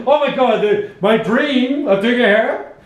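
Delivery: high, slowed mock awe; hammed-up dream-come-true gush; intense gushing. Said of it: "this intense, like".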